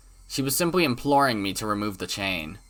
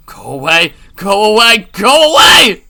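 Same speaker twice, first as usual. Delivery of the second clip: builds from loud to a very high scream; escalating scream; 10 semitones higher, 14 dB louder than usual. Acted. Keiichi pulling the door on Rena, escalating scream. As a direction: scream harder each time.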